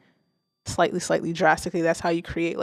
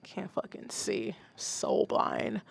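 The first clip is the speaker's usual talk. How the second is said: muttered to self; 11 dB quieter, slower than usual. Real, not acted. Squinting at chat on screen.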